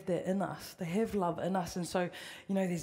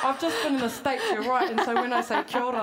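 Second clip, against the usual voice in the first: laughing; 4 semitones higher, 5 dB louder than usual. Real, not acted. Laughing about the States.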